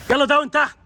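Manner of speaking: insistent command. Real, not acted. Ordering dog to lie down. (أمر بإلحاح وبصوت مرفوع)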